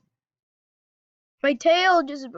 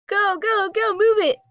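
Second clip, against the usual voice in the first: high loud go go go; barked order; 7 semitones higher, 5 dB louder than usual. Real, not acted. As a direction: bark it at the ship. Urging ship to move.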